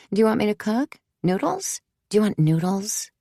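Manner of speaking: high, gentle coaxing. A narrator's reading. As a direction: coax a little child with questions. Mother coaxing a small child.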